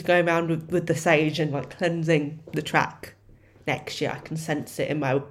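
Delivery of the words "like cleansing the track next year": normal talking voice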